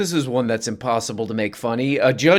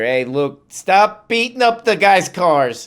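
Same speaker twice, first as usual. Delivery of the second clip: higher, louder put-on Trump voice; mock Trump; 8 semitones higher, 5 dB louder, slower than usual. Mocking Trump's PR push for the cars, in a hammed-up put-on voice. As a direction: ham up Trump pleading for the cars. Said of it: "kinda looks like they're doing a P R thing here".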